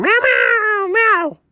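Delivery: high, mocking little meows; cat meowing. Imitating a cat bursting into the girls' room, high mock meows. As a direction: meow like a cat barging into the room. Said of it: "Just a cat running in going".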